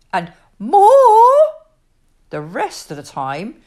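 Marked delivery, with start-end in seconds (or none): 0.6-1.4 put-on high, loud toddler demand. A narrator's reading. Annie Rose using one of her four words, loud toddler demand. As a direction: call it out like an insistent small child. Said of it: "She could say only four words".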